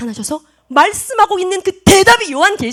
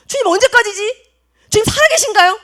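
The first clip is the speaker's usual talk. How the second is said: high pleading cries, rising to loud; crying out; 9 semitones higher, 7 dB louder than usual. Acted. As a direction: beg God for an answer, building to a cry. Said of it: "라고 외치고 있는 거예요".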